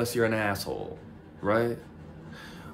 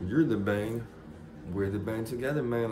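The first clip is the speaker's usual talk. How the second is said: normal talking voice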